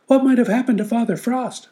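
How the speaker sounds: worried little animals asking; high squeaky wondering animals. Acted. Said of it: "the animals wondered".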